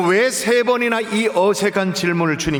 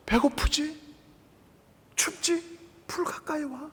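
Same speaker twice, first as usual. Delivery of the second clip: much softer, gentle invitation; tender; 6 semitones higher, 9 dB quieter than usual. Retold or imitated. Jesus softly inviting Peter to the fire, tender. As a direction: coax him close, very softly.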